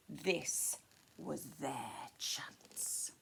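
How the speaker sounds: slow, hushed whisper; scheming whisper. A narrator's reading. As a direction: lean in and whisper the threat slowly. Goblins plotting.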